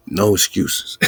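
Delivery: usual speaking voice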